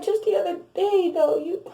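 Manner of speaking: high, loud outrage; outraged disbelief. Retold or imitated. Reacting to the ex's birthday post, outraged and loud. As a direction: burst out in indignant disbelief.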